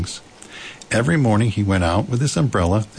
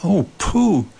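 high, dismayed call; crying out; 10 semitones higher, 4 dB louder than usual. A narrator's reading. Christopher Robin calls for missing Pooh, crying out. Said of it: "cried Christopher Robin".

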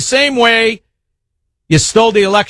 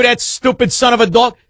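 ordinary delivery

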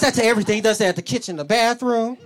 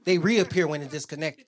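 normal talking voice

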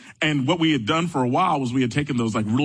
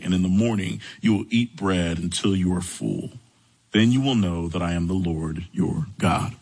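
lower, measured Bible reading voice; solemn scripture reading, lower and unhurried; 4 semitones lower than usual. A narrator's reading. Reads scripture passage aloud.

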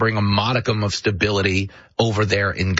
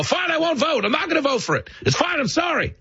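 much higher, flustered mock apology; giving-in voter. Average voter caves to the hecklers, much higher flustered mock apology. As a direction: cave in just to end it. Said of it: "They go".

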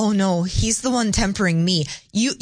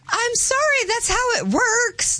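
high, slow prissy official; prim official voice; 13 semitones higher, slower than usual. Pretend. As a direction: play a snooty bureaucrat saying no. Mock official refusing the request.

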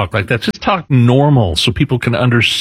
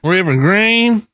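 higher, twangy imitation of a politician; mock Southern accent; 5 semitones higher than usual. Co-host mimicking a church speech, mock Southern accent. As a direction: echo it with a put-on Southern twang. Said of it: "Hillary doesn't sound like that".

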